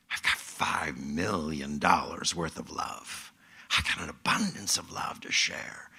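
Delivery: low, hushed stage whisper; awed whisper. Acted. Preacher voicing someone realizing their abundance of love, hushed. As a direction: whisper the discovery, slow at first.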